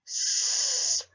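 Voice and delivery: long hissing S sound; drawn-out s like a snake. Shown as a demonstration. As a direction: hiss the S like a snake. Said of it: "it sounds like a snake".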